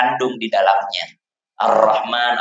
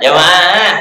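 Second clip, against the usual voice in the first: angry shout; 5 semitones higher, 5 dB louder than usual. Retold or imitated. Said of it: "Orang marah dia".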